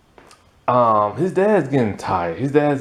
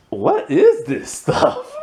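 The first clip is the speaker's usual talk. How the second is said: put-on much higher, slow, laughing kid voice; imitating the puzzled kids; slower than usual. Kids opening odd gifts, high slow laughing whine. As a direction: draw it out high like a baffled child. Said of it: "they're all like".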